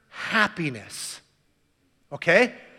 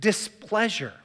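normal talking voice

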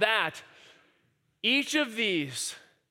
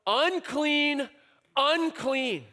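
higher, drawn-out call of unclean; 7 semitones higher, much slower than usual. Retold or imitated. Priest's rule read aloud, a mild mock call. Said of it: "cry out".

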